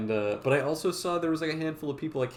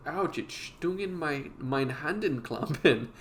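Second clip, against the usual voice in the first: slow, higher fake German accent; 4 semitones higher, slower than usual. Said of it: "doing fake German".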